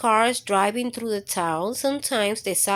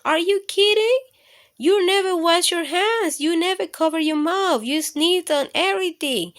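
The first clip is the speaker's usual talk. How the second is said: indignant protest; 6 semitones higher than usual. Acted. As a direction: push back hard at the germs. Simon protests to the germs.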